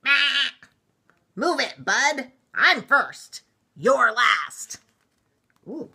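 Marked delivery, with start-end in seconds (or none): 0.0-4.8 loud, high, pushy shout; blaring truck. A narrator's reading. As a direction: honk it out like an impatient truck. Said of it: "gave his horn a blast".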